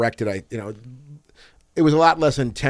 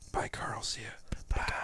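whispered goodbye to a guest; whispering; 3 semitones lower, 15 dB quieter than usual. Real, not acted. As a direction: say farewell in a hushed whisper. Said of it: "Why are we whispering?".

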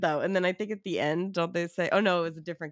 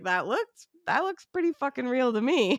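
higher, punchy mock disbelief; sarcastic incredulity; 6 semitones higher, 3 dB louder than usual. Viewer mocking the guests who call it fake, sarcastic disbelief. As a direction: scoff at their denial.

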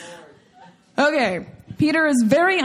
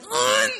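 high-pitched shocked gasp noise; sudden high vocal gasp without an intake of breath; imitated collective gasp; 11 semitones higher than usual. Imitating a whole family's shock, collective gasp. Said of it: "it was not a collective gasp".

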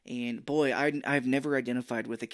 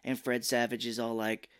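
ordinary talk

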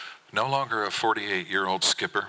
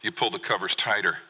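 plain reading voice

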